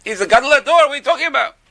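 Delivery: high-pitched retold outrage; acted scolding, pitched well up; indignant. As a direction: imitate an outraged admirer scolding. Acted outrage after smacking him.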